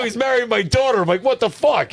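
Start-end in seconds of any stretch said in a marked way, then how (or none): 0.0-1.0 high-pitched comic outrage; hammed-up disbelief, voice shooting up; mock pissed-off pastor. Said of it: "This pastor must have been pissed". 1.2-1.9 high-pitched, exasperated outburst; hammed-up comic outrage; mock mad pastor. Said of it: "he must be mad".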